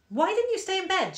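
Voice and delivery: put-on high-pitched bossy young daughter; fussing child. A narrator's reading. Organised daughter questioning her mother about bed, high fussing child.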